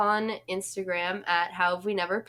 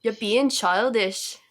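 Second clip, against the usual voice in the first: slow, drawn-out mock-British scolding; bad British accent; 2 semitones higher, slower than usual. Podcast host playing a Love Island row, bad British accent. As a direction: attempt a British telling-off. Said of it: "so bad at British accents".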